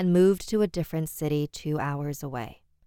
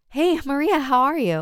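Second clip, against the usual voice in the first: high, bright hopeful hello; eager greeting; 10 semitones higher, 6 dB louder than usual. Acted. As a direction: greet her like an old friend. Hoping it's Maria calling.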